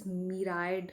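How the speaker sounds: myriad said as "mi-RIDE"; mispronounced myriad. Shown as a demonstration. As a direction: say myriad with a long "eye" in the last syllable, "mee-RIDE". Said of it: "करके प्रोनाउंसिएट मत करिएगा".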